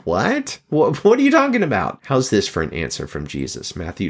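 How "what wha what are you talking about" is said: high, stammering mock protest; incredulous. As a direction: act out baffled disbelief at an unreasonable demand. Acting an objector answering Jesus.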